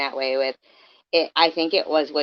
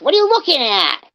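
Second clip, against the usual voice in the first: mock husband snapping, higher and louder; angry husband; 10 semitones higher, 5 dB louder than usual. Joe barks at his wife, mock angry. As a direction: bark it like a jealous husband.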